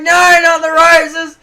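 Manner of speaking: high, loud, slow anguished shout; stepdad shouting. Retold or imitated. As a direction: shout to save the roses. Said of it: "He'd be like".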